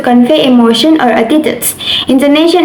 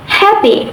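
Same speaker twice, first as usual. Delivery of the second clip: "happy" with HAP higher than the ending; first-syllable stress; 7 semitones higher than usual. Shown as a demonstration. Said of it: "the first syllable is stressed".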